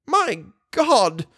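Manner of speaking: put-on high, shocked old woman; gasping exclamation. A narrator's reading. Old woman hears of danger, gasping exclamation. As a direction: exclaim high as if short of breath. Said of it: "exclaimed the good, simple old woman, gasping for breath".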